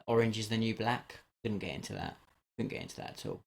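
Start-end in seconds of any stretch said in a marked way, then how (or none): 2.6-3.4 hushed whispered aside; whispering. Shown as a demonstration. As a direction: drop to a breathy whisper. Repeats the line, then whispers it.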